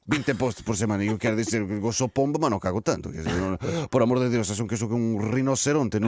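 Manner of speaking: put-on flustered Portuguese in a comic Spanish accent; put-on Spanish accent, stumbling along. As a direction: make excuses in hesitant Spanish-accented Portuguese. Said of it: "estou com sotaque espanhol".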